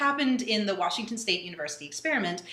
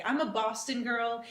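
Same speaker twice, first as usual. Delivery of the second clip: ordinary delivery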